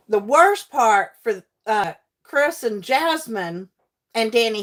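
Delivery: ordinary delivery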